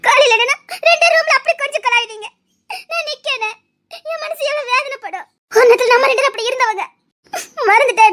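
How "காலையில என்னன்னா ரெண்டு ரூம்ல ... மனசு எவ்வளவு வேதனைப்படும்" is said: sobbing through the hurt; plaintive, crying reproach; tearful complaint. Acted. Woman tells her lover how much she was hurt, crying. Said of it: "சின்ன பிள்ளை மாதிரி அழுதுட்டு இருக்காத".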